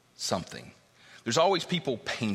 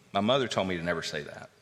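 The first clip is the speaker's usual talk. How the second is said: usual speaking voice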